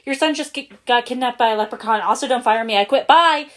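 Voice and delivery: high, loud, frantic rush of words; panicked and rapid. A narrator's reading. Terrified general blurting the news to his boss, frantic. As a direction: blurt it all and flee. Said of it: "quaked in his costume and said rapidly".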